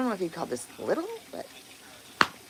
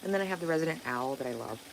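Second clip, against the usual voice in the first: ordinary talk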